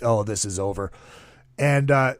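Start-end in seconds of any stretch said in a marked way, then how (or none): 0.0-0.9 low, quiet resigned mutter; resigned aside. Retold or imitated. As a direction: mutter it, defeated. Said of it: "And I'm like".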